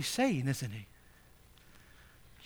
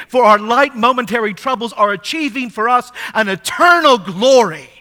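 louder, higher, urgent pulpit voice; preaching at full force; 6 semitones higher, 11 dB louder than usual. Acted. Preacher urging the poor congregation, louder and higher. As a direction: preach it out to the whole church.

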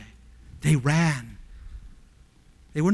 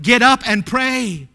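raised, slow urgent order; louder, higher acted command; rousing command; 6 semitones higher, 8 dB louder, slower than usual. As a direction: call them up from sleep, stretching it out. Preacher voices Jesus rousing sleeping disciples.

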